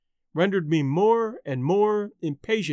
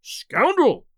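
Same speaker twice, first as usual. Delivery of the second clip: high, loud furious cry; enraged outburst, pitched high; mounting fury; 7 semitones higher, 5 dB louder than usual. A narrator's reading. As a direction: let the rage flare up into a louder accusation. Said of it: "new fuel to my fury".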